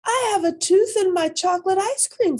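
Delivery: a little rabbit shrieking with delight over her tooth; excited discovery. Acted. Child excited finding tooth.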